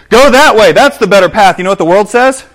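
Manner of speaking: loud, high, coaxing sales pitch; the world tempting loudly; enticing world. Acted. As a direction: lure them in with big enthusiasm.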